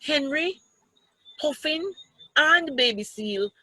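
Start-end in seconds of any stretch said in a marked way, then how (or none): none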